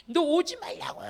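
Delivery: high, loud mock scream; retold screaming. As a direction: imitate a frantic woman screaming to keep away. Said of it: "막 소리, 소리 지르고 난리가 나요".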